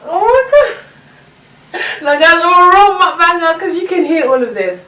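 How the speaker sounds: high, loud, fast thrill; excited squeal. Real, not acted. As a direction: gush with delight. Crunchy food delight.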